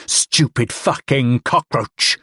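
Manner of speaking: slow, dragged-out hatred; slow raging snarl. Acted. Man slamming drunk's head, slow raging snarl. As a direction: grind each word out.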